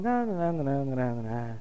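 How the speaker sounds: soft, low singsong "na, na, na" from God; mocking refusal. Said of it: "the Lord's sitting up there going".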